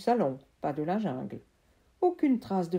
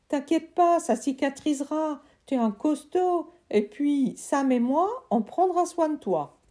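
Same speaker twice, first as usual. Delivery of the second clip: put-on higher, loud boy's voice; trapped friend; 8 semitones higher, 5 dB louder than usual. Friend trapped in the tree urging Sam, high loud voice. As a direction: call out from inside the trunk.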